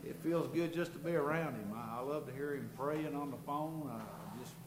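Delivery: ordinary talk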